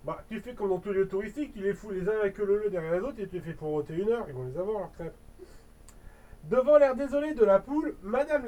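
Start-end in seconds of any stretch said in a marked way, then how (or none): 0.0-5.2 fast sarcastic aside. Real, not acted.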